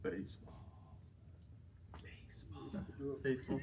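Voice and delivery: soft whisper close to the ear. Real, not acted. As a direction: whisper the word into one ear. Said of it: "I'm gonna whisper some words to you".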